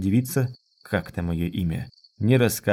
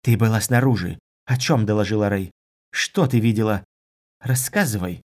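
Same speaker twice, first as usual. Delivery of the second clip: plain reading voice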